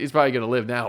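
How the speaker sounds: ordinary delivery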